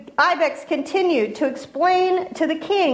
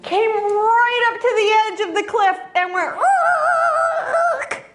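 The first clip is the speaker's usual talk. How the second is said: horse skidding cry; 7 semitones higher, slower than usual. A narrator's reading. Horse skids at the cliff.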